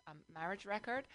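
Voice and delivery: usual speaking voice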